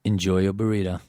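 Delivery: "Enjoy your burrito" in a relaxed South African accent. Shown as a demonstration. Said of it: "would you take us out that way".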